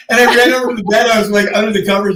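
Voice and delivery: excited retelling. Real, not acted. Telling the scary night, fast and excited. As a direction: rush it out breathlessly.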